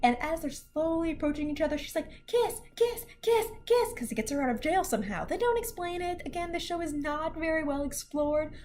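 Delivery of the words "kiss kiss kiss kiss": cutesy high voice. Retold or imitated. Character coaxing a kiss.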